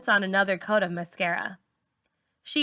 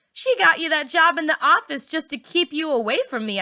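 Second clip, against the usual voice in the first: put-on high taunting teen girl; accusing, pitched up high; Char's taunt; 8 semitones higher, 3 dB louder than usual. A narrator's reading. Char accusing narrator.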